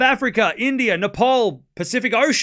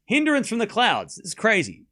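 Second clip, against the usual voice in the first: ordinary talk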